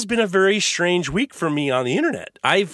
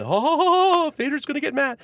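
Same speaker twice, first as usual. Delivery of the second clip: mock teasing, pitched way up; winding someone up; 10 semitones higher than usual. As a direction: imitate gleeful goaders in a high voice. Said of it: "trying to wind me up".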